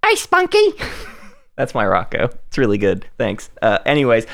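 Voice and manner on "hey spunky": very high cartoon squeak of Hey, Spunky; Rocko impression. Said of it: "I can do my, uh, my Rocco's Modern Life".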